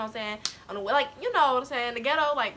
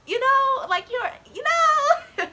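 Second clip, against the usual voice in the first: cry-laughing; 13 semitones higher, 6 dB louder, slower than usual. Real, not acted. Cry-laughing over the memory.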